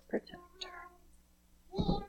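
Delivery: breathy whispered prayer; hushed whisper to close the prayer. Real, not acted. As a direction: whisper it like a secret blessing. Closing prayer over the guest.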